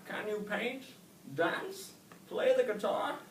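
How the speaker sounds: put-on old snail questioning young Oscar; wise old snail. A narrator's reading.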